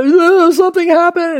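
high, loud alarmed blurt; panicky guards. Acted. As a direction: burst out like scared guards. Frightened guards report to priests.

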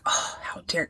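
whispered mock indignation; mock offended whisper. [name] answering Blake's accusation, in an offended mock whisper. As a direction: act out a gasp of offence under the breath.

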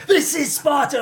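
high, booming mock Spartan king; hammed-up movie quote shout; Spartan battle cry. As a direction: belt it like the film's king, hammed up. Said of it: "I was doing all that".

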